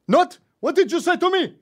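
high, loud outraged shout; furious cab driver, voice shooting up; offended cabbie. Acted. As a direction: explode in accented indignation. Offended cabbie in acted quarrel.

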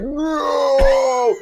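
high, loud, drawn-out movie scream; imitated scream. Retelling the birthday party scene, screaming up in the air. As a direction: scream it skyward, stretched long. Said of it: "he screams up in the air".

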